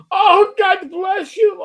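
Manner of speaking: loud, high, drawn-out wail; hammed-up kvetching cry; mock Yiddish exclamation.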